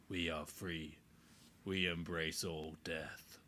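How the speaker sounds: low, slow, whispered cult chant; sneering gloating dark voice. Acted. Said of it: "Do your necromancer voice".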